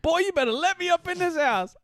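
hammed-up grandma demanding to be let in; imitating grandma. Grandma voiced in retold story.

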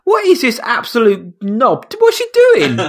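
high-pitched, baffled disbelief; incredulous outburst. Acted. Viewer mocking the mind-reading scene, incredulous. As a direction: climb way up in pitch, exasperated. Mock outrage at a film villain.

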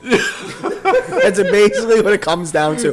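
high, loud laughing delivery. Real, not acted. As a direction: spill it out between laughs. Mocking Brian's arrogance.

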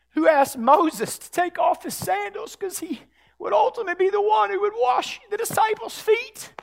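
very high, impassioned preacher's shout; climactic preaching. Real, not acted. Preacher declaring the God of the Exodus, climactic preaching. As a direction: stretch the big words and cry them out.

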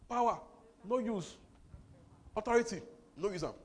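loud, high outburst; preaching shout. Real, not acted. Preacher rebuking unused power, loud and high. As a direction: proclaim it with force. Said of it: "preacher shouting point".

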